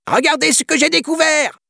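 high, loud shrieking monkey cry; monkey shout. Acted. Monkey showing off the child to the troop, high shrieking shout. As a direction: shriek it out like an excited little monkey. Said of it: "s'écria un singe".

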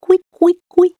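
very high squeaky couic couic; high mouse squeaks. A narrator's reading. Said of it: "en petite souris".

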